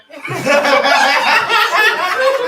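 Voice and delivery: high loud burst of laughter; big laugh. Real, not acted. GM laughs at scene.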